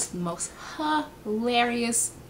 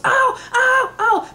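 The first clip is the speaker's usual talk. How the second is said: high, loud cries of alarm; mock panic; 7 dB louder, slower than usual. Imitating friend heard through closet door, panicked yelps. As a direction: yelp in mock terror, voice way up. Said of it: "All I hear outside was".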